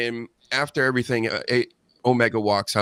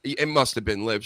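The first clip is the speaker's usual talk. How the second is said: usual speaking voice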